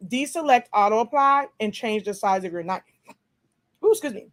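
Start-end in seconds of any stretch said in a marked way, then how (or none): 3.8-4.3 hiccup interrupting speech; catching a hiccup mid-sentence. Real, not acted. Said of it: "I got the hiccups".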